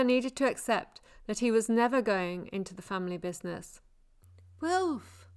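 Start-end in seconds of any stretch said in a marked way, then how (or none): 4.6-5.3 a name heard as if from afar; distant call. Acted. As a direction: call from far away, fading. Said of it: "The goalie's voice drifted away".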